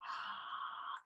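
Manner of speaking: long audible breath out, mirror-fogging; steaming breath. Shown as a demonstration. Yoga teacher to class, demoing a steaming breath out. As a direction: exhale audibly as if steaming glass. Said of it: "So start steaming your mirror".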